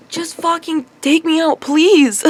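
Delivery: loud, high, drawn-out begging; exasperated plea. Retold or imitated. Pleading to the coworker she likes, loud exasperated begging. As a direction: plead to him in mock frustration. Pissed off, inner plea to crush.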